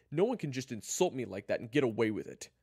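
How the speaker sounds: loud, high indignant outburst; angry insistence. Acted. Kyler argues with June over the insult, angry. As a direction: protest the insult heatedly.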